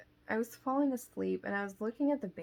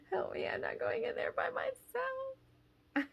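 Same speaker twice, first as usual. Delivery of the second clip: very high, slow, squeaky plea; mock scared whine. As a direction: draw out a hammed-up frightened squeal. Acted scared plea to husband.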